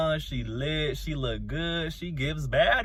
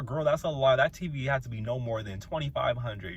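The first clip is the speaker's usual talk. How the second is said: ordinary talk, nothing marked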